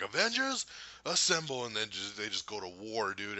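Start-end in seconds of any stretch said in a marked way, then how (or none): 0.0-1.6 high, drawn-up heroic call; battle rallying call. Retold or imitated. Fan acting out the long-awaited line, rallying call. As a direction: imitate a hero rallying the troops. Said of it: "He's just like".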